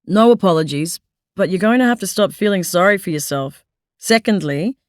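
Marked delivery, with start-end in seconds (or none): none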